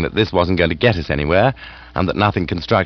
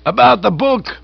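higher, louder, gushing aged aunt; hearty aunt voice; 12 semitones higher, 4 dB louder than usual. Acted. Aunt gushing to Bertie about lunch. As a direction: raise the pitch and relish the food.

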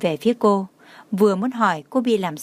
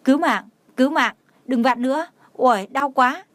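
higher pained pleading cry; mock agony, pitched up, half-hearted yell; crying for help in pain; 4 semitones higher than usual. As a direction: beg for mercy while the finger is twisted. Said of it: "mồ hôi nhỏ từng giọt kêu to".

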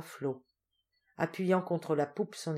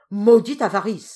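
loud, raised-pitch curse; angry exclamation; 4 semitones higher, 14 dB louder than usual. A narrator's reading. Fisherman stamping his foot at her avarice, furious exclamation. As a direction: explode with exasperation. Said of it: "s'écria Diogo en frappant du pied".

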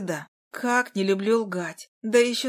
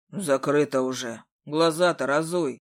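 put-on low grumbling old carousel man; 6 semitones lower than usual. A narrator's reading. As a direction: grumble at the girl. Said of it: "пробурчал он". (ворчливый старик)

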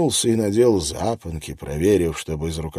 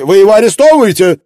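furious editor; 12 semitones higher, 13 dB louder, much faster than usual. Acted. Angry editor demands.

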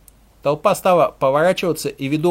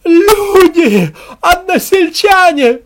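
very high, loud drawn-out call; wailing female shout; woman's cry; 14 semitones higher, 10 dB louder, much slower than usual. A narrator's reading. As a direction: cry out to the whole village. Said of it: "раздался женский крик".

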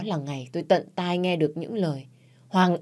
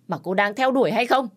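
angry accusation; 6 semitones higher than usual. Acted. Mother-in-law shames Quỳnh.

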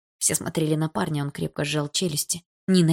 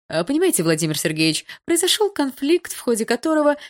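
placating explanation; 9 semitones higher, 4 dB louder than usual. Acted. Inspector starts explaining to intimidating father.

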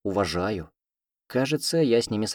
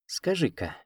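narrowed-eyes suspicion, higher and soft; suspicious; 7 semitones higher, 5 dB quieter than usual. A narrator's reading. Heroine eyes the cat with suspicion. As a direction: say it slowly, doubting him. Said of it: "подозрительно сощурилась я".

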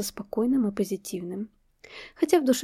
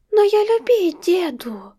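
put-on high, slow pouting little girl; a hurt small child protesting; offended toddler; 10 semitones higher, 8 dB louder, slower than usual. A narrator's reading. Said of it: "обиженно надула губки".

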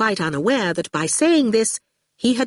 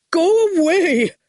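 high, slow, louder bleat; 11 semitones higher, 5 dB louder, slower than usual. A narrator's reading. Mister Caldwick bleats at Torquil to leave, high slow bleat. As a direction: wail it like a bleating goat. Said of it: "Mister Caldwick bleated".

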